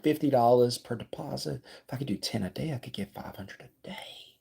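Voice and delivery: inner voice sinking into a whisper; talk-to-myself voice. Acted. Doing the math to himself on the way home, hushed to whispering. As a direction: start hushed, fade down to a whisper. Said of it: "That's my talk to myself voice".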